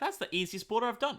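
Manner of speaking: high, loud self-quote; hammed-up pleased exclamation; mock premature triumph. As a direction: crow it loudly, imitating your own confidence. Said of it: "I was like".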